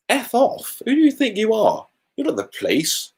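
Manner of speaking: mock outrage at a bossy driver; mock indignant retort.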